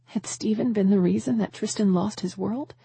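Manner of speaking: plain reading voice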